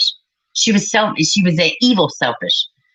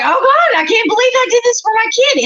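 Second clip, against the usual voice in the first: much higher, a mock gasp of horror; shocked parent. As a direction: play the appalled parent who bought the doll. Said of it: "they're like".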